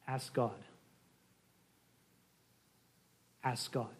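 soft, low and simple; a quiet answer after suspense; hushed reveal. Real, not acted. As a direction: let it land softly, low in pitch. Mock-mysterious reveal after a pause.

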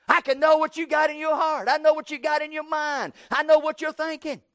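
high, insistent shout, hammering each claim; mock yelling. Arguer claiming to know another's heart and mind, yelling. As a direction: yell it over the other person's objection. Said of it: "somebody's yelling at you so loud".

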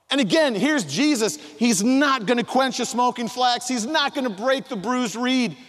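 full-voiced, high, loud preaching. Pastor preaching at full force to his church. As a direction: lift the congregation with a soaring sermon.

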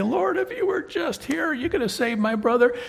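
high, pleading sister's voice; grieving sister. Acted. Martha pleads with Jesus over her brother, high and pleading. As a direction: beg him as the grieving sister. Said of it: "she's gonna say".

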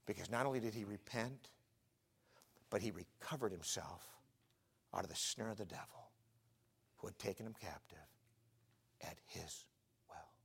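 low, soft, with long pauses; hushed close. Real, not acted. Preacher closing Paul's story of escape from the devil, hushed. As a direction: let it sink toward a whisper.

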